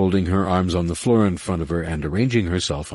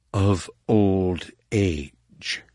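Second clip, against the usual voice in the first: very slow, deadpan delivery; slow dry punchline; much slower than usual. Acted. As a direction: let each word drop slowly.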